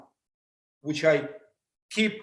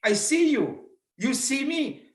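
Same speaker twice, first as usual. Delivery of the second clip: higher, cheerful hellos to friends; light up greeting a friend; warm greeting; 6 semitones higher, slower than usual. Retold or imitated. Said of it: "at least we can say".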